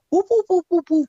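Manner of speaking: high, loud Three Stooges whoop; mock whooping. Commentator mocking the Three Stooges, high loud whooping. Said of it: "Chris Jericho on commentary and go".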